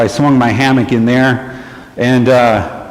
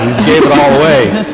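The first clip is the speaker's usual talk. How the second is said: usual speaking voice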